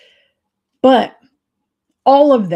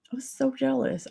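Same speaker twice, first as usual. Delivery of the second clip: a higher, hushed echo of "I was so jealous"; quieter repeat; 6 semitones higher, 7 dB quieter than usual. Real, not acted. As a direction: say "I was so jealous" again, softer and higher, dropping away. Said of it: "Like, I was so jealous".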